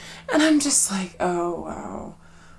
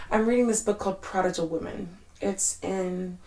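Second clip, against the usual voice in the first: normal talking voice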